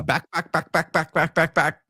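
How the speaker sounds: lightly voiced home run call; rising back back back like a TV call; a hint of a sportscaster calling a homer; Chris Berman call. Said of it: "Feels like Chris Berman".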